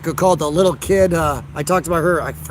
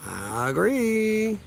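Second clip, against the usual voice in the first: "I agree" stretched very slow and higher; drawn-out agreement; 4 semitones higher, 7 dB quieter, much slower than usual. Shown as a demonstration. Streamer relishes agreeing with a commenter, drawn out slow. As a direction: draw "I agree" out long, savouring it.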